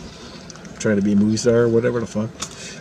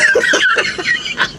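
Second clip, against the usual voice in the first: real, helpless high-pitched laughter; high cackling laugh. Real, not acted. Laughing at the coworker's panicked exit, high cackling laugh. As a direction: crack up at your own story. Laughing at her own retelling.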